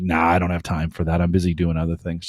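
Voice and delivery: low, soft, lightly dismissive brush-off; mock indifference. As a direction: imitate someone who can't be bothered. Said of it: "they don't care".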